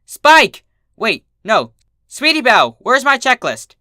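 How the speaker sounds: very high, loud frantic yell; frantic scream. Acted. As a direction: shout for her checklist in a panic.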